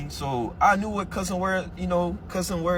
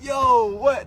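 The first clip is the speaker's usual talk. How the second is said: higher, louder disbelieving yo what; stunned yo what; 9 semitones higher, 6 dB louder than usual. Retold or imitated. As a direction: act out being floored. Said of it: "I said".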